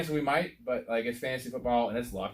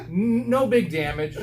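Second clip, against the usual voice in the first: laughing through words; 7 semitones higher, 4 dB louder, slower than usual. Real, not acted. Still laughing from the story.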